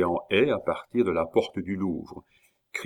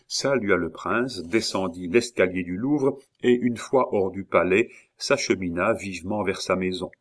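ordinary delivery, nothing marked

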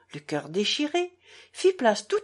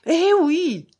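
a sharp, vexed burst, louder and higher; irritated; 8 semitones higher, 8 dB louder than usual. A narrator's reading. Ivan, vexed at being interrupted, answers with loud irritation. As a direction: snap it out, annoyed at the interruption. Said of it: "avec une sorte d'irritation et visiblement vexé".

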